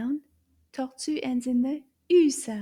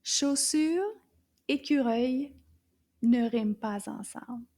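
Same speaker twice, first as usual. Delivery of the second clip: normal talking voice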